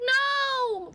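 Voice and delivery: high playful protest. Real, not acted. Refusing to return the duck, a high playful protest.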